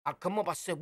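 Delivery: ordinary talk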